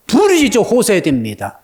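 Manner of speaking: normal talking voice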